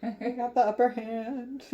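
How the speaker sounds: high-pitched gloating singsong; playful, high villainous gloat; mock taunting. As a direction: tease like a smug rival, breaking into laughter. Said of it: "just taunting him".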